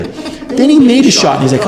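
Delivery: ordinary talk, nothing marked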